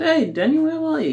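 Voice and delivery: very high, lively mock phone hello; cheery phone greeting. Caller greets Daniel on the phone, chirpy and high.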